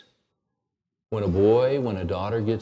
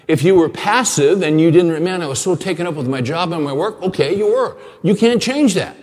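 raised, louder preaching push; fervent preaching; 4 semitones higher, 6 dB louder than usual. Acted. Pastor urging fathers from the pulpit, fervent preaching. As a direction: drive it home to the congregation.

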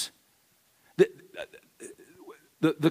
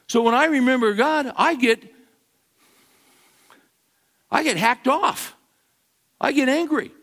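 fervent preaching; 9 semitones higher, faster than usual. Acted. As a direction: preach it hard and stir the room. Pastor preaching at full force.

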